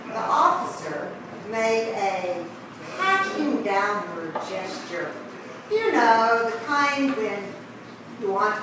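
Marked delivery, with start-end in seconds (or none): none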